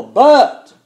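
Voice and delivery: "but" held loud and high as the turning point; stressed but. Shown as a demonstration. Preacher turning the sermon on the word "but". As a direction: land on "but" with force. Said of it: "everything that comes after that word qualifies".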